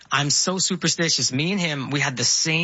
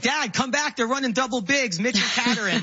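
higher-pitched imitation of an eager kid; excited seven-year-old son; 8 semitones higher than usual. Seven-year-old calls dad back to the game, imitated in a high, urgent voice. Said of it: "he's like".

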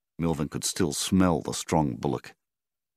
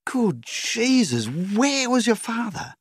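much higher, slow panicked mother's cry; a frantic mother at the sight of blood; 3 dB louder, slower than usual. A narrator's reading. Mother seeing her bleeding son, panicked high cry. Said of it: "in a whirlwind of panic".

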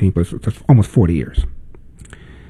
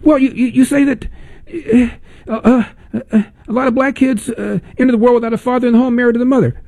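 mock caller; 14 semitones higher, faster than usual. Host mimics the caller's weak example.

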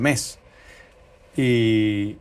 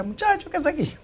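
high-pitched imitation of startled grandma; surprised grandmother. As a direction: mimic her astonished question, pitch climbing sharply. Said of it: "Mi abuela".

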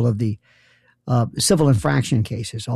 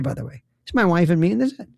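normal talking voice

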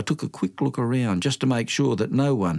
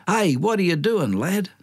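high, raised call across the pub; 8 semitones higher than usual. Retold or imitated. Horse tailor calls out to the boy ordering beer, high raised call. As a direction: call out to the lad from the doorway. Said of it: "he calls out".

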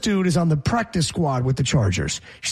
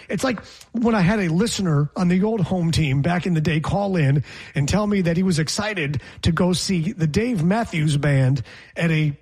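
usual speaking voice

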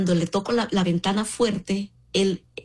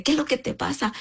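higher quoted man; 7 semitones higher than usual. Retold or imitated.

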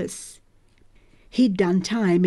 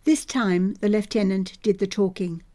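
ordinary delivery

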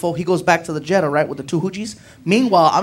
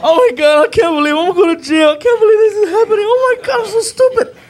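much higher, louder panicked wail; near tears panic; 14 semitones higher, 5 dB louder than usual. Retold or imitated. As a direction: wail like you're going to jail. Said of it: "I'm on the verge of tears".

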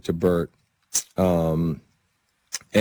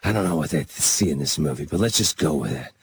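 lightly voiced older mentor, pitched lower; low-key, lower-voiced impression of a veteran star; Burt Reynolds; 6 semitones lower than usual. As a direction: imitate him with a slight drop in pitch. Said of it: "So he goes".

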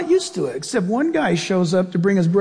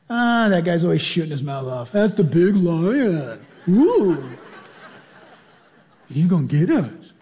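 lightly voiced mother zebra; 4 semitones lower than usual.

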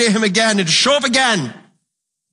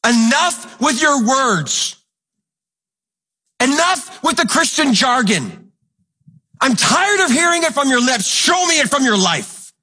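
slow, higher, stern rebuke; Joshua's rebuke; 6 semitones higher, slower than usual. Retold or imitated. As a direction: lay down the challenge slowly. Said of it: "he's like".

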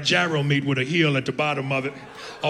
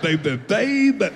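slow, strained caricature voice; hammed-up throaty noise voice; mock throat noise; 4 semitones higher, slower than usual. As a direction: drag the words out through a put-on choke. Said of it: "what the fuck that noise means".

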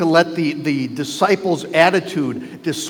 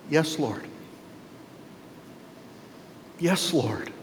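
soft, submissive acceptance; humble assent; 2 semitones lower, 12 dB quieter than usual. Retold or imitated. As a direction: say it softly, humbly yielding. Woman humbly accepts Jesus' word.

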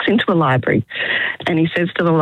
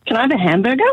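voicing the man in the joke; 5 semitones higher, faster than usual. Man in joke asks librarian.

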